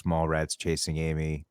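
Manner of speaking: normal talking voice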